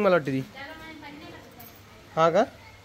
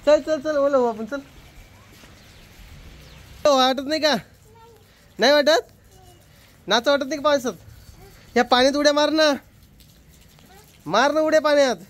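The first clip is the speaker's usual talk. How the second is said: excited urging; 8 semitones higher than usual. Real, not acted. Father urging daughter to jump in water, excited calling. Urging child to play in rain.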